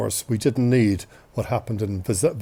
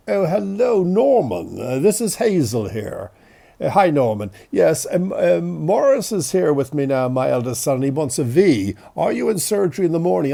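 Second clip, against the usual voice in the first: raised, louder telephone manner of a doctor; mother's doctor voice; 4 semitones higher, 3 dB louder than usual. Retold or imitated. Doctor mother phoning a colleague about her son, professional doctor voice. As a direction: put on mum's professional phone voice. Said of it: "with her m-, doctor's voice is different".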